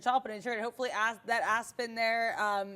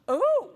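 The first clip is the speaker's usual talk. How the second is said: exaggerated surprise; 12 semitones higher, 8 dB louder than usual. Streamer reacting to PayPal's chart for amused viewers, showy gasp and ooh. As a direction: gasp and burst into a showy, high-pitched ooh. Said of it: "When you do that".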